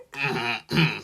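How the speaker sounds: awkward throat clear. A narrator's reading. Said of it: "cleared their throats".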